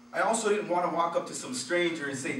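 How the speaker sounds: normal talking voice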